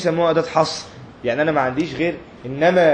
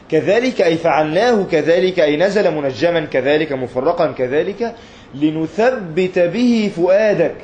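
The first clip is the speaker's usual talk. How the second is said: very slow, louder, drawn-out words; emphatic verse reading; 3 semitones higher, 4 dB louder, slower than usual. Acted. Preacher reads the verse's explanation to the class, slow and loud. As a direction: draw each word out like a sermon climax.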